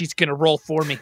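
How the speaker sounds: ordinary talk, nothing marked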